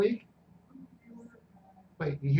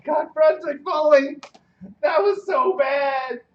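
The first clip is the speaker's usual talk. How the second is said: drawn-out groan; 6 semitones higher, 4 dB louder, slower than usual. Real, not acted. Groaning about a bad game.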